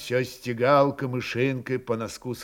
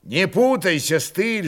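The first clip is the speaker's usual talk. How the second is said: stern; 5 semitones higher than usual. A narrator's reading. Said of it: "жёстко сказал серьёзный Исаул".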